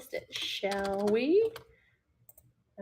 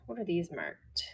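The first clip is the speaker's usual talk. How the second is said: ordinary delivery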